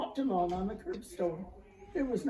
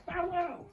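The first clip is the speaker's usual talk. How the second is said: high, light little dog bark; polite little barks; 10 semitones higher than usual. A narrator's reading. Little dog asks the shop for stockings with polite barks. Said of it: "two of his most polite little barks".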